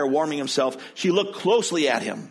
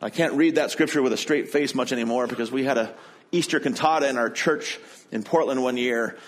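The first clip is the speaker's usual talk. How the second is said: usual speaking voice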